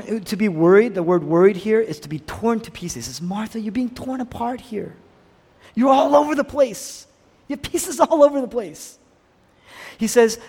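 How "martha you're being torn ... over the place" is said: urgent pleading. Acted. Jesus telling Martha she's torn apart, high and urgent. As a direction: press the point with rising intensity.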